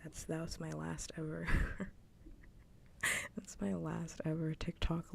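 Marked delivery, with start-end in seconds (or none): 1.5-3.3 short, unvoiced laugh of disbelief; breathy laugh. Real, not acted. Streamer swearing off TikTok lives, breathy laugh. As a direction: laugh it off under your breath. Laughs about last ever live.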